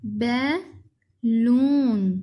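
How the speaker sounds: Balloon as "बै", long pause, drawn-out "लून"; split into syllables. Shown as a demonstration. Teacher breaking up a word, balloon split in two. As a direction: say balloon very slowly in two parts. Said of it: "इसके अलग-अलग".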